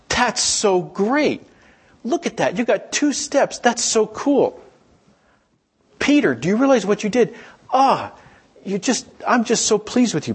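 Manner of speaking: high, drawn-out gushing delight; delighted praise. Acted. Jesus thrilled at Peter's two steps, delighted praise. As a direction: cheer like a proud parent at first steps. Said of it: "Jesus is saying".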